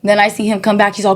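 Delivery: ordinary delivery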